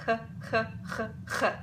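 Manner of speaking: throaty German ch; German ach sound. Shown as a demonstration. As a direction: scrape the ch in the throat. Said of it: "you can pronounce that h sound".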